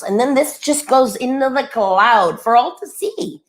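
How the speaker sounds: normal talking voice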